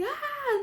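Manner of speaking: sharp high shouted ah; tense shrieking ah; high yelled ah. Shown as a demonstration. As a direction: show the wrong kind of scream. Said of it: "O meu grito, gente, não é esse".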